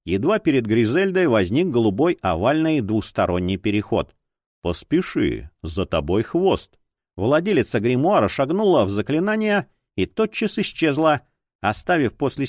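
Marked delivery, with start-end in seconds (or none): 4.6-6.8 low, good-natured man's voice; soft male voice. A narrator's reading. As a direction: urge gently in a low male voice. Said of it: "добродушный мягкий мужской голос".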